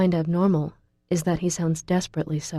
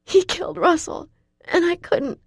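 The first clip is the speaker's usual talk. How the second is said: much higher, thin, breathless and frantic; thin breathless panic; 12 semitones higher, 3 dB louder than usual. A narrator's reading. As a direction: warn him desperately, gasping for air. Horrified, breathless confession.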